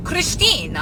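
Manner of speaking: lightly imitated Italian aunt's accent, pitched high; thick Italian accent. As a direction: call out the name with a hint of Italian. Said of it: "with this thick Italian accent".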